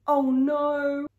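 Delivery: alarmed. A narrator's reading. Disaster in story.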